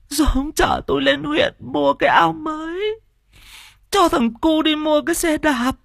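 high wailing lament for a dead husband; sobbing wail. A narrator's reading. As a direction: cry out his name, voice breaking high. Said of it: "khóc nấc lên". (giọng khóc than nức nở của người vợ)

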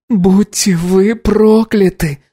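slow, high drawn-out moan; moaning curse. Acted. Anna cursing the crew, slow anguished moan. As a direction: groan it out in grief. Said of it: "простонала Анна".

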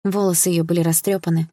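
usual speaking voice

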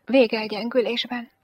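ordinary delivery, nothing marked

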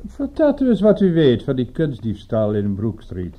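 forceful demand. Acted. Client demands information from detective.

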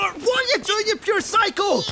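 high, loud outraged shriek; shrieked protest mid-struggle; alarmed yell. Acted. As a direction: yell in disbelief while fending someone off. Fighting off the phone grab.

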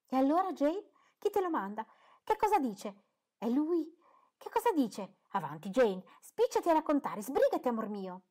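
high, loud, eager questions; a mother bursting with curiosity; impatient mother. A narrator's reading. Mother hurrying her daughter over a letter, high and eager.

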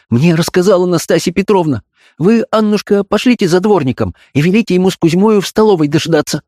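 high-voiced, agitated instructions; agitated mistress. A narrator's reading. Mistress sends maid for the yard-keeper, agitated. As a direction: give orders in a high, agitated woman's voice. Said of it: "взволнованно ответила Софья Антоновна".

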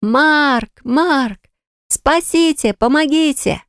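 high panicked calls for help; yelling for help. Acted. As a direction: shout out as you flee. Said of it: "ору на ходу".

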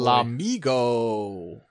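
drawn out. Acted. Announcing the silly name drawn out.

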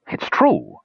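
high-pitched protest; insistent. A narrator's reading. Dwarf defends himself to accuser, insistent. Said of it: "insisted Mertwig".

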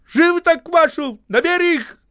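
high, loud command; urgent shout. A narrator's reading. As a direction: bark it to the whole crew. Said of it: "крикнул Осип".

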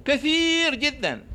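high, drawn-out "كثير"; emphatic exaggeration. Doctor exaggerates the seed count to patient, emphatic exaggeration. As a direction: stretch "كثير" way up high to sound huge. Exaggerating how many seeds.

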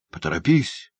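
urgent call, rising on the repeat; Феджин urging cry. A narrator's reading. Prisoner hurrying the boy toward escape, urgent cry. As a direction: hurry him along, building to a cry. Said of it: "крикнул Феджин".

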